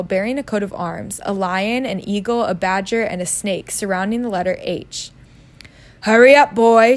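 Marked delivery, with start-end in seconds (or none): 6.0-7.0 put-on loud uncle shouting for the boy; raised voice, calling sharply. Uncle calls from the kitchen to hurry the boy, shouting. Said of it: "shouted Uncle Vernon".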